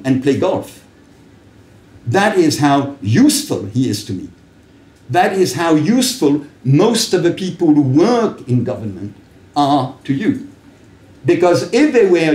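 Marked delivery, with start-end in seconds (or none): none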